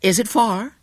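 high, eager American lady; eager tourist's question. A narrator's reading. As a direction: ask keenly, pitched well up. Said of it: "said the fair American eagerly".

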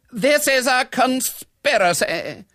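high, loud, drawn-out declaration; melodramatic, high-pitched accusation; dramatic outrage. A narrator's reading. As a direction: proclaim it theatrically. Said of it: "said Missus Beverton dramatically".

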